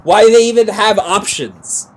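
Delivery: loud, rushed, exasperated complaining; angry rant. Real, not acted. Gamer venting at the game for failing him, loud and quick. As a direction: vent it fast and loud.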